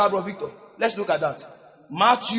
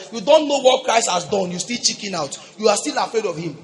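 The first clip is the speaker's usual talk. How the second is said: ordinary delivery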